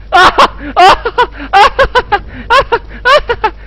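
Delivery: high, long peals of laughter; relieved laughing fit. Real, not acted. Player after the scare is over, high relieved laughter. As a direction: laugh in bursts until it thins out.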